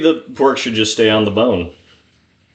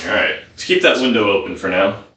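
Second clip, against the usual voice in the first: normal talking voice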